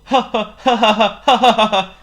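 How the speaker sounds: high, loud string of ha-has; effortful mock chuckling; attempted chuckle. A narrator's reading. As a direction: try out a chuckle as a novice would. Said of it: "The king tried to chuckle".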